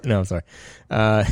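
ordinary talk, nothing marked

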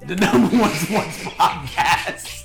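show intro hype. Acted.

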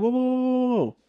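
very high, defensive mock voice; squealed mock protest; imitated backpedaling. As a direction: imitate someone hurriedly backing off, way up high. Imitating actor backing off in quarrel.

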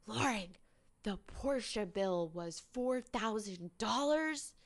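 surprised husband. Retold or imitated. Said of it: "his reaction of surprise".

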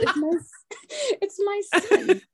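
high, laughing quote of the lady; laughing through the hairdresser's answer; laughing while quoting. Retold or imitated. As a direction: break into laughter mid-quote.

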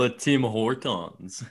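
"Le Tim Hortons" slowly in a light mock French accent. Joking French store names.

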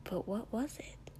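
soft unvoiced whisper; whispered thought. A narrator's reading. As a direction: whisper it to yourself.

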